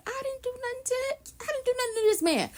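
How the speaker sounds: high, soft pleading denial; mock innocent plea. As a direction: play the wronged innocent. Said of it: "she was like".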